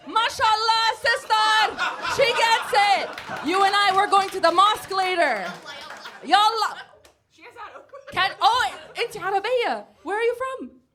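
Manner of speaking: high, loud, gleeful calls to an audience member; excited crowd shout. Real, not acted. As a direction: greet her like a long-lost sister.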